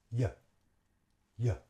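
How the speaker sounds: "Yeah" as a very short "yuh"; reduced schwa. Shown as a demonstration. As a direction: cut "you" down to a quick "yuh". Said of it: "We say".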